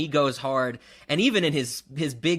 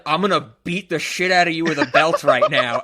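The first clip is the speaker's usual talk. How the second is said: louder, menacing villain line; imitating Sator's louder menacing threat; mock threat; 2 semitones higher, 7 dB louder than usual. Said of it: "he's like".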